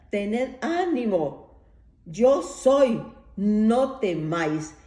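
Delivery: slow, loud call across the water; Jesus shouts to the frightened disciples. Retold or imitated. Preacher voices Jesus calling loudly to the disciples in the dark. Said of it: "el Señor les grita".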